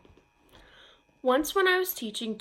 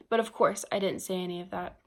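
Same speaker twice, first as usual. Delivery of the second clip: normal talking voice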